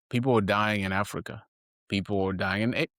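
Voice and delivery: ordinary talk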